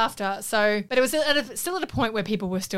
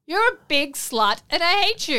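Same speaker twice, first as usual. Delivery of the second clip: higher-pitched, hammed-up insult; mock hateful insult; 7 semitones higher, 3 dB louder than usual. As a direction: spit out a pretend insult for laughs. Acting out the insulting reply.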